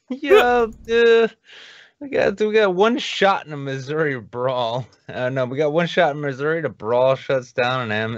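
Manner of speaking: ordinary delivery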